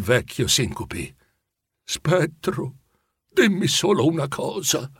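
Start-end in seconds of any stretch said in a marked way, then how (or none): none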